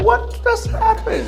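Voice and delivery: a stunned laughing wonder; baffled. Retold or imitated. Husband marvelling at his wife's mood flip, laughing surprise.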